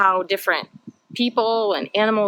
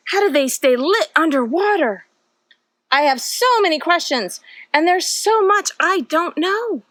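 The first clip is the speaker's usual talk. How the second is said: put-on lively storybook read-aloud for little kids; reading to children; 5 semitones higher, 3 dB louder than usual. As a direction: read it like a bedtime picture book, full of wonder. Reads picture book to children.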